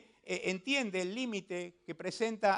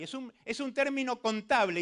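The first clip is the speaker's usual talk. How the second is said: usual speaking voice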